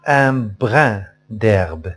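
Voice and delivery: "Un brin d'herbe" slowly, brin stressed; slow model reading. Shown as a demonstration. (قراءة نموذجية بطيئة)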